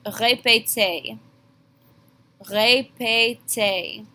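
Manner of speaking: Répétez, ray-pay-tay, slow and even; teacher modelling for students; slow French demo. Shown as a demonstration. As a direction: model it slowly. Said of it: "ask in class by saying".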